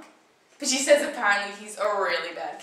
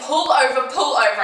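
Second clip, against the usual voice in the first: high, loud, pressing plea; urgent alarm; 5 semitones higher, 10 dB louder than usual. Acted. Telling Julia to pull over as she stares backwards, urgent. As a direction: raise the voice in rising panic. Urging driver to stop.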